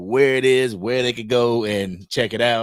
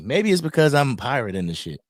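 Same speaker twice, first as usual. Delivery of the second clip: normal talking voice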